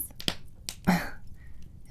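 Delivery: a quiet, airy demon laugh; soft breathy chuckle. Acted. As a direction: chuckle under your breath, amused.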